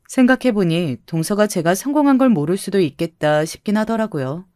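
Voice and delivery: usual speaking voice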